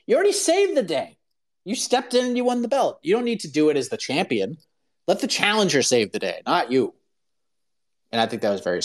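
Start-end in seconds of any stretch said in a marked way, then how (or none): 0.1-1.2 much higher squealing team. Retold or imitated. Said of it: "they're like". 1.7-6.9 pleading team. Retold or imitated. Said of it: "they're like".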